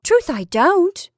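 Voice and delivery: high, loud, drawn-out protest; protesting cry. A narrator's reading. Wife insisting to suspicious husband, protesting cry. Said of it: "she cried".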